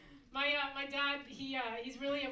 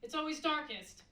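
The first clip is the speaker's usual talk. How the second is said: cheery half saying; 5 semitones higher, 5 dB louder than usual. Retold or imitated. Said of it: "being like".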